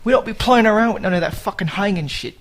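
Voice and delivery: a hint of a London tough-guy British accent; bad British accent. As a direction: ham up a cockney British accent, badly. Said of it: "that's a terrible, terrible accent".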